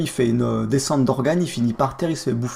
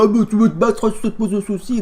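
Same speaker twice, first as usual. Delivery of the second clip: high-pitched caricature of a boastful oaf; show-off yokel; 7 semitones higher than usual. Retold or imitated. Jean Beauve trying to impress his Vanessa, mock yokel swagger. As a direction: put on a high yokel voice, picking a fight. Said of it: "Jean Beauve qui va vouloir impressionner".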